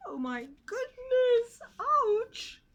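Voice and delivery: high, loud yelp of pain; shocked cry. Acted. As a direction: cry out after the crash. Pig crashes to ground.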